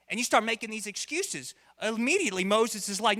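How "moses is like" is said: usual speaking voice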